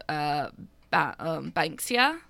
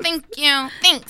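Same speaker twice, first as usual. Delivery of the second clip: mock guest thanks; 10 semitones higher, 9 dB louder, slower than usual. Pretend guest at door.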